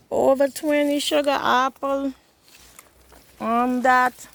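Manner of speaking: high, fast excited chatter. Real, not acted. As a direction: gush about the fruit. Excited over sugar apple tree.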